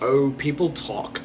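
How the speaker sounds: soft, dismissive shrug of a reply; half-hearted excuse. Retold or imitated. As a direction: brush it off lazily. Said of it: "some half-hearted excuse".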